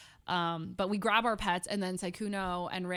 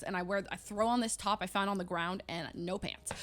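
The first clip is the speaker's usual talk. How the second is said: usual speaking voice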